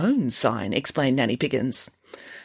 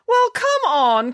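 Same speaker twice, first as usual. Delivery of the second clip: high, loud, drawn-out call; 5 dB louder, much slower than usual. Acted. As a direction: call them into the tent from inside. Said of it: "called Nanny Piggins".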